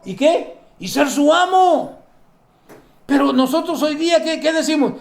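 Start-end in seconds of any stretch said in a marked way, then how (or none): none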